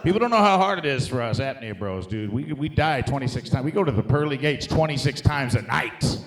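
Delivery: ordinary delivery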